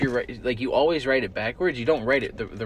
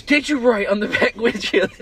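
mom scolding; 12 semitones higher, 11 dB louder than usual. Retold or imitated. Mom scolding, voiced in story.

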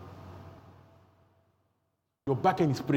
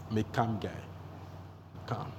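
calm; 7 semitones lower, 10 dB quieter than usual. Real, not acted.

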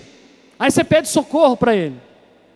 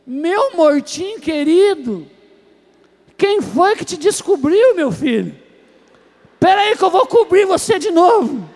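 put-on slow, higher, coddling drunk voice; drunk man; 5 semitones higher, slower than usual. Drunk talking sweetly to the corpse he thinks he found. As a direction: coo at the grave like a tipsy uncle. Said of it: "ele olhou e falou assim".

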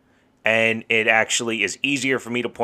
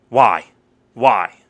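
exasperated loud why; 7 dB louder than usual. Real, not acted. Ranting about a ruined match.

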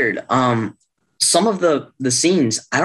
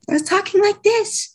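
squeaky, much higher voice; really high voice. Shown as a demonstration. Actor mocking his early recordings in a squeaky high voice. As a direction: pitch it way up to mock your own early takes. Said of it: "your voice is, like, really high".

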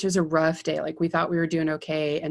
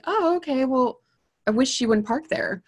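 high, sugary fake politeness; sweetly polite with an edge; mock-polite high voice; 8 semitones higher than usual. Faking politeness to neighbor.

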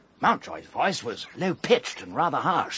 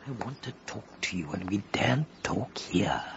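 whisper; 7 semitones lower, 6 dB quieter than usual. Acted. Secret talk away from others.

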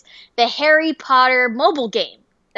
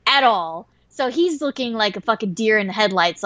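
normal talking voice

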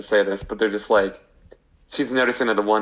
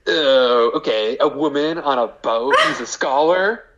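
higher-pitched hammed-up trailer voice; movie trailer parody; 4 semitones higher than usual. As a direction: sell it like a goofy comedy trailer. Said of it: "like, movie trailer".

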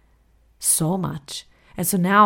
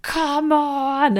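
high, strained come on; exasperated at the dice, pitched way up; frustrated at the dice; 11 semitones higher, 4 dB louder than usual. Retold or imitated. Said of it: "I'd be like".

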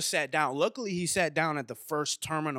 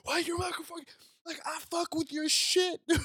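starstruck gushing; 12 semitones higher than usual. Retold or imitated. Starstruck fan gushing at author.

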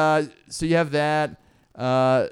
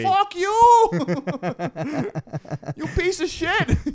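heckler yelling; much slower than usual. Retold or imitated. Imitating Chicago heckler.

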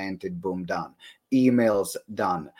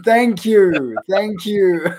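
high, loud, jokey gratitude; mock-flattered thanks; 9 semitones higher, 8 dB louder, much slower than usual. Host joking about a compliment on the accent, mock-flattered. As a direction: gush it out, much higher and louder.

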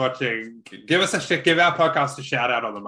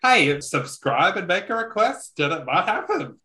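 announcer plug; 8 semitones higher than usual. Acted. Plugging the channel to viewers.

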